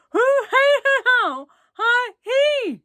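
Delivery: high, loud garbled yell without teeth; toothless yell. A narrator's reading. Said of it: "yelled the boy".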